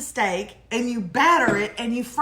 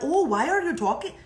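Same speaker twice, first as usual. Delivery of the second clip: lightly put-on Upper Midwest accent; mock Michigan accent; 5 semitones higher than usual. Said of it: "They're like".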